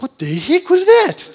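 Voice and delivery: high-pitched, startled disbelief; baffled outburst. Retold or imitated. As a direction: blurt it out, bewildered by yourself. Startled by his own strange word.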